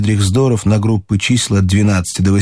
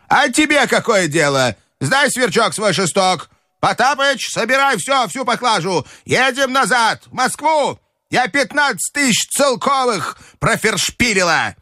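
put-on high, scolding old lady's rant; furious old grandmother; 14 semitones higher than usual. A narrator's reading. Grandmother berating the Frenchman, high furious rant. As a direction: tear into the Frenchman.

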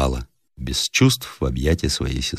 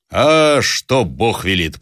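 bluff squire; 5 semitones higher, much slower than usual. Acted.

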